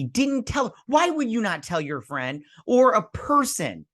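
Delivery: high, fast outraged questioning; real indignation. Real, not acted. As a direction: protest the unfair treatment. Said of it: "I'm so angry about this episode".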